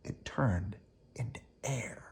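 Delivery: soft airy whisper. A narrator's reading. Reading to children, whispering the candy turned to air. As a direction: let the words vanish into breath. Spooky vanishing in story.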